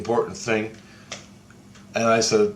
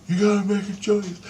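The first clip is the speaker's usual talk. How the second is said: high-pitched mock wife voice; imitating his wife; 9 semitones higher than usual. Quoting his wife urging him to decide, high imitation. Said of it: "And she goes".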